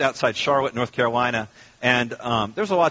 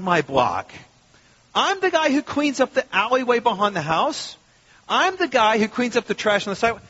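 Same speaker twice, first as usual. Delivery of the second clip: mock indignant outrage; 8 semitones higher than usual. Pastor mocking his own fury at a city fine, indignant. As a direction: puff up like an insulted local hero. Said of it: "I was furious about this".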